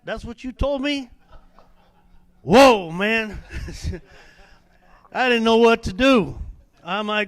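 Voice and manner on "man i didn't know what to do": normal talking voice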